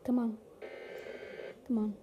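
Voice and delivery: ordinary talk, nothing marked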